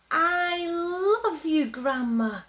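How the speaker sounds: high, drawn-out call of love; gleeful little bear calling to Grandma; affectionate yell. A narrator's reading. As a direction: call it out high, stretching the words. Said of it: "Little Bear yelled".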